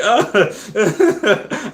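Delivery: high laughing oh, overcome; laughing overwhelmed. Retold or imitated. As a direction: laugh as you break down. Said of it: "And I was like".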